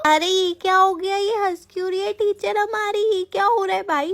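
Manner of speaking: amazed student; mock shock. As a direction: gasp at the teacher's niceness. Student shocked teacher is smiling.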